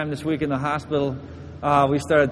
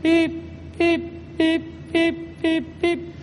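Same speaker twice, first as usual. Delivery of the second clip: high, short beeps coming a little quicker; imitated monitor beep; 11 semitones higher, much slower than usual. Preacher mimics the monitor as heart races, high beeps. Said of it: "So it started going beep".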